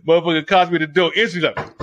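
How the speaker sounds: normal talking voice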